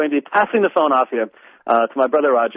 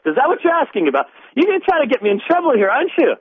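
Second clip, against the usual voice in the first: high, fast mock protest; higher, quicker teasing complaint; 12 semitones higher, faster than usual. Real, not acted. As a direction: play the accused, amused and fast. Teasing Roger about trap question.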